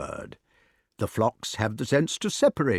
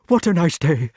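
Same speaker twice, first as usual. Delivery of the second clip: higher, louder, breathless fright; 7 semitones higher, 6 dB louder than usual. Shown as a demonstration. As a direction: gasp it out scared. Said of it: "quite breathless".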